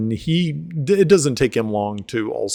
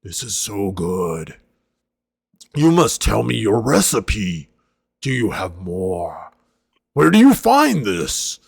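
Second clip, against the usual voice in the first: a gruff-sounding half-orc, gushing and greedy for more; hearty half-orc; 5 dB louder than usual. Acted. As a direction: voice the big half-orc begging for the recipe. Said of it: "he says".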